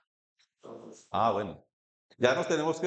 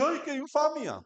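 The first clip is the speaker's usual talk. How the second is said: a high, mock old lady's gasp of scandal; lightly voiced scandalized old woman; old lady exclaiming; 9 semitones higher than usual. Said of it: "cuando la viejita dice". (viejita escandalizada, con voz aguda)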